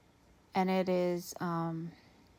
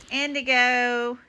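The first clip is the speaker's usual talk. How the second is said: excited call; 4 semitones higher, 11 dB louder than usual. Real, not acted. Calling the bird with a laugh.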